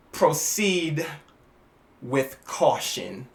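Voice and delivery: slow, soft menacing catchphrase; a touch of villain menace; sinister slow taunt. Retold or imitated. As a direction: whisper the threat, then laugh. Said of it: "she smiles".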